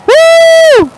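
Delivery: a loud high woo; real whoop of joy; high whooping cheer. Real, not acted. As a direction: whoop out to the sunset crowd. Cheering out over the sunset.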